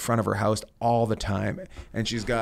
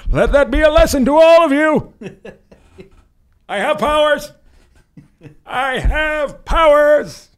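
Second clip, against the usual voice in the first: very high, loud mock villain shout with laughter; yelled off the balcony; 11 dB louder than usual. Shouting from the balcony at the neighbours, loud, high and laughing. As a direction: bellow it like a gloating sorcerer, cracking up. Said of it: "I yelled off the balcony".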